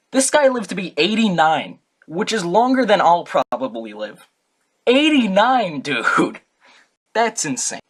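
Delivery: high, loud disbelief; real excitement over eighty-nine years; amazed. Real, not acted. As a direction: marvel at the number. Amazed at his age.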